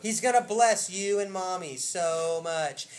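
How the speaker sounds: slow, loud, evenly spaced delivery; emphatic slow repeat. Retold or imitated. Preacher repeating Isaac's blessing to congregation, slow and emphatic. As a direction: spell out each word with weight.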